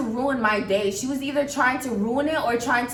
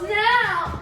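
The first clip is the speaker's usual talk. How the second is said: commanding friend; 9 semitones higher than usual. Retold or imitated. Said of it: "She goes".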